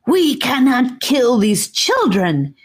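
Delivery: high, slow, piercing snake moan; ear-splitting moan. A narrator's reading. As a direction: wail it like a monstrous snake. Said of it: "she moaned in an ear-splitting tone".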